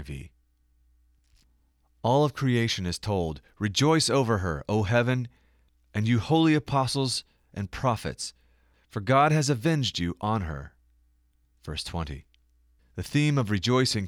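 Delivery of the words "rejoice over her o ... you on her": scripture reading. Acted. Scripture quote read aloud.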